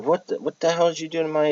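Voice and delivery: high, stunned disbelief; mock outrage at the damage; shocked outburst. Reacting to hole in roof.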